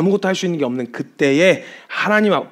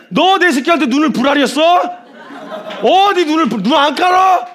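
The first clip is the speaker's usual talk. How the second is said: high, full-voiced enraged shouting; stern, furious warning; 11 semitones higher, 7 dB louder than usual. God berates the king who glared at his child, acted fury. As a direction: roar each accusation at the offender. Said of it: "주님이 엄히 경고를 하시는데".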